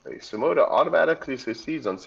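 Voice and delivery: ordinary talk, nothing marked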